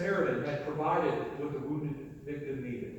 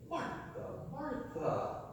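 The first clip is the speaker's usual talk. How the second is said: hushed sigh; 8 semitones higher, 6 dB quieter, much slower than usual. Retold or imitated. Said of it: "Can you hear the exasperation there?".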